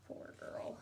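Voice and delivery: breathy whispered aside; whispered pity. A narrator's reading. Narrator pitying the girl, whispered.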